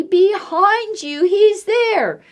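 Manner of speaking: put-on loud, high child's scream; screaming boy. Eddie warning Mom about the bear, loud.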